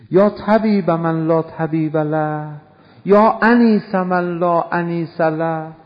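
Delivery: measured, insistent invocation; prayer recitation. Acted. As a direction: recite it drawn out and projected.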